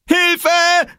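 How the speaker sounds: very high, loud scream; screaming for help. A narrator's reading. Small boy fleeing his pursuer, scream for help. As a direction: shriek as if about to be caught. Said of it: "schrie Oskar".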